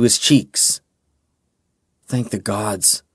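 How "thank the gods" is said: soft, relieved breath of thanks; relieved whisper. Acted. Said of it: "Kalen whispered".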